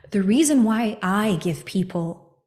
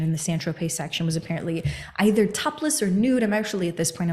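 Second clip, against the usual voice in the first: ordinary delivery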